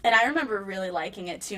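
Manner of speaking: ordinary talk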